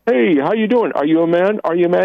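a hint of a hearty grandpa; lightly voiced grandpa. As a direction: tease the little one, pitch lifted. Caller voices grandpa greeting grandchild.

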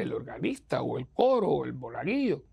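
usual speaking voice

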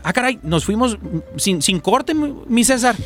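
oops, we skipped the break; sudden realization. Real, not acted. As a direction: pitch jumps high, startled and amused. Realizes they missed the break.

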